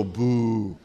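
low disapproving boo. Shown as a demonstration. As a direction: give it a thumbs-down boo. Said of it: "put your thumb down and just go".

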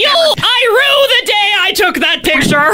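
mock melodramatic villain grumble; sputtering foiled villain. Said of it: "she shakes her fist at it".

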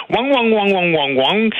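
high, nasal-free sing-song wang wang; mocking fake Chinese sounds. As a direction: imitate a crude fake Chinese accent. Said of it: "imiter un accent chinois".

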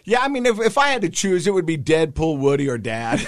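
put-on high, loud kid voice conceding a point; imitated son. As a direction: play a child reluctantly adding to the list. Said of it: "And then my son goes".

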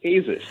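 Asus as AY-zus, high, for repeating; model pronunciation. Shown as a demonstration. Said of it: "say it after me".

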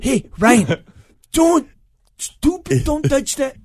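high, scolding dad voice; mock parental telling-off, pitched right up; imitating dad. Son doing dad scolding a child, high mock telling-off. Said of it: "can you do my dad's accent".

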